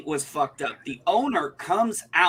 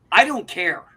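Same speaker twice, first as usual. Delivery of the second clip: louder, curt retold snap; dismissive mother; 4 semitones higher, 6 dB louder than usual. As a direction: toss it off like a rude shop owner's mother. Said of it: "the first words out of her mother's mouth was".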